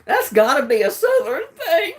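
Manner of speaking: laughing through the words; giggling while saying it. Real, not acted. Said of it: "That has definitely got to be".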